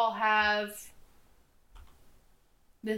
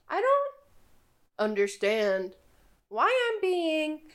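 patch the sentence from different voices; mismatched voices; 6 semitones higher than usual. Acted. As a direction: creature voice switching pitch mid-sentence. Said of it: "mismatch of voices throughout the sentence".

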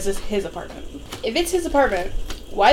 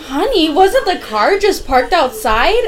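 high, slow, half-laughing alarm; a hammed-up wife realising the car is gone; mock shocked gasp; 8 semitones higher, 3 dB louder, slower than usual. Wife on the phone to the detective, mock shocked and slow. Retelling the detective's panicked call.

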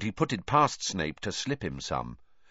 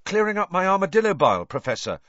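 much higher, fast and innocent; 11 semitones higher, 7 dB louder, faster than usual. Acted. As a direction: play the innocent schoolboy. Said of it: "said Harry innocently".

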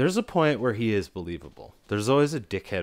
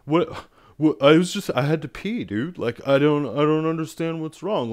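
higher, louder, whiny dude voice; imitating Mark; 7 semitones higher, 7 dB louder than usual. Clueless tourist protests to the angry villagers, high and whiny. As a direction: mimic a clueless guy who just peed on a tree.

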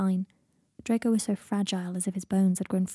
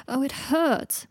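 higher-pitched moan of pain; pained complaint; 6 semitones higher, much slower than usual. Acted. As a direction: whine about the pain. Draco complains of his aching head.

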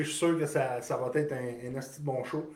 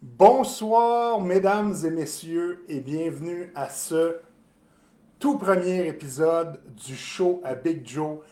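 ringmaster; 4 dB louder, slower than usual. Acted. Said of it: "show opening announcement".

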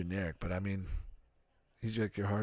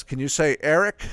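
the dad goes; 8 semitones higher, 12 dB louder than usual. Retold or imitated. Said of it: "And then the dad goes".

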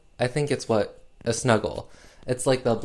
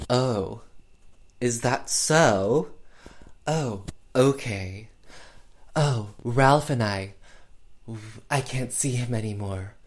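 Faye Dunaway as Barbara. Retold or imitated. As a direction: draw each phrase out with unvarying attitude. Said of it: "one attitude. It's always very".

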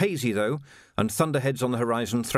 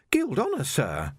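put-on much higher, polite young Jocasta, a touch breathless; young woman voiced; 5 semitones higher than usual. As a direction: play a well-mannered girl treading water. Jocasta looking hurt.

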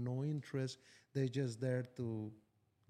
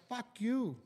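retold shout; 8 semitones higher than usual.

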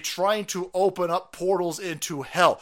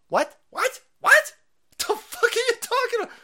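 higher and louder each time, incredulous; outraged what; 5 dB louder than usual. Real, not acted. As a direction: climb up in disbelief. Outraged disbelief at claim.

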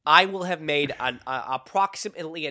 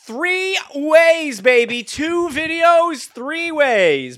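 excited; 10 semitones higher, 6 dB louder, much slower than usual. Real, not acted. Hyping their other channel.

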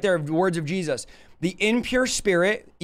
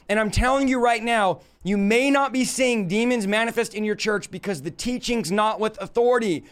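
ordinary talk, nothing marked

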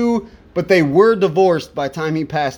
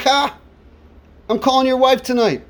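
higher, cocky taunt; mock smack talk; 6 semitones higher than usual. Singer taunts another dude, cocky teasing. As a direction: needle a buddy, teasing. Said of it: "It's just talking smack".

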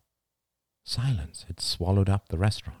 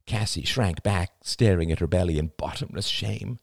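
usual speaking voice

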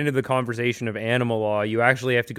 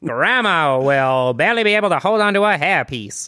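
higher vintage ad voice; 1940s voice; 5 semitones higher, 5 dB louder than usual. Pretend. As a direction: pitch it like an old car ad. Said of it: "nineteen forties voices".

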